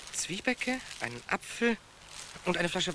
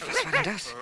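startled; 13 semitones higher than usual. Acted. Startled by a bang at night.

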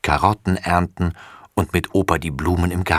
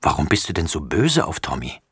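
ordinary delivery

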